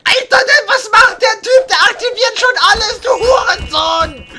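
yelling in frustration, high and loud; furious gamer rage; angry shout. Real, not acted. Raging at another player.

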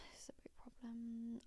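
hushed, whispered verdict; whispered aside. Real, not acted. Hushed aside while reading dilemma.

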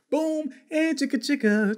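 high hype rapper ad-lib; [name] impression. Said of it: "I'm just joking. That was a [name] line".

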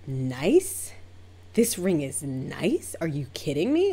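drawn-out, incredulous rising questions; slow offended echo, swooping upward; insulted disbelief. Retold or imitated. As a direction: imitate an affronted client, scandalized. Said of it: "insulted that that's my reaction".

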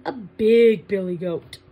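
big goat voice. Shown as a demonstration. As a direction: make the big goat sound huge. Counting goats little to big.

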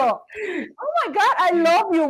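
high, drawn-out delighted cry; swooning, high-pitched outburst; gushing exclamation. Retold or imitated. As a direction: re-enact being bowled over with admiration. Acts out delighted reply to stranger.

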